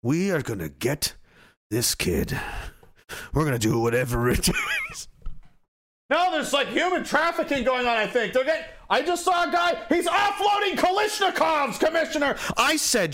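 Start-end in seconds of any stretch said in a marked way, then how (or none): 0.0-5.1 grim commissioner. Pretend. Commissioner vowing to catch the kid, low menace. 8.3-12.4 high urgent shouted report; frantic shout. Pretend. Officer reporting arms dealers, high frantic shout.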